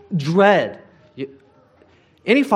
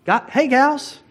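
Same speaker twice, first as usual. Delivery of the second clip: put-on higher, light friendly hello to old friends; cheery Jesus greeting; 7 semitones higher, much slower than usual. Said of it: "Jesus is like".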